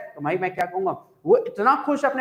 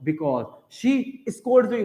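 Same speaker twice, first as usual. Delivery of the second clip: each word drawn out very slowly; slow teacher dictation, word by word; very slow example; much slower than usual. Shown as a demonstration. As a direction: dictate "she scolds me as" at a crawl. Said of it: "as का मतलब हो गया because".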